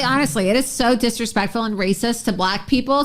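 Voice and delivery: usual speaking voice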